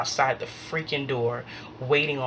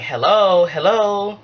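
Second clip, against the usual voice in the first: louder, higher hello into silence; impatient hello; 5 semitones higher, 6 dB louder, slower than usual. Retold or imitated. Caller getting no response on the phone, impatient hello. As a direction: call out to a silent phone line. Unanswered caller trying again.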